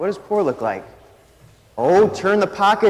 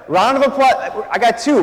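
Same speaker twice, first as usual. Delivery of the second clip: normal talking voice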